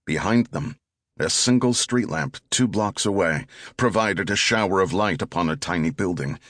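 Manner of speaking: ordinary delivery, nothing marked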